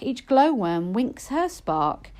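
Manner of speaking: plain reading voice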